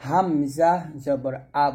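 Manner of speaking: slow, syllable by syllable হামজা; measured recitation ending on a clean আ; spelling-out drill. Shown as a demonstration. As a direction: draw out হামজা, then say আ. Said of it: "বলবো".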